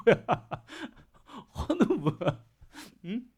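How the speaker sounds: real laughter through the words; laughing at her own joke about the fasters. Real, not acted. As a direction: giggle out the question.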